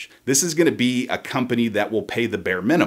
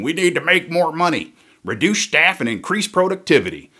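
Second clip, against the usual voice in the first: higher, louder fat-cat boss; greedy corporate boss voice; 7 semitones higher, 4 dB louder than usual. Retold or imitated. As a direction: bark orders like a cartoon CEO.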